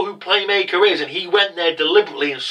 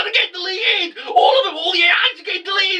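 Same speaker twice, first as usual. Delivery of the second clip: very high, fast wailing shout; mock panic; faster than usual. Worker screaming at his boss, high mock wail. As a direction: wail the news in hammed-up terror.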